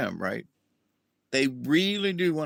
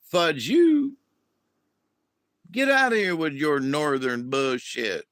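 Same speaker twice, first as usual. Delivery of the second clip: defiant Southerner. Retold or imitated. Southerner telling off northern newcomers, mock-angry brush-off. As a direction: tell them off, Southern style. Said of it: "And the Southerners like".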